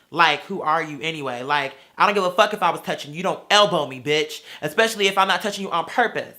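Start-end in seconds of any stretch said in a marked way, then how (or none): none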